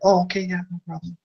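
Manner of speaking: high, easy mock forgiveness; mock forgiving. Retelling forgiving another driver, high and breezy. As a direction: melt into cheerful forgiveness. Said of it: "and I'll be like".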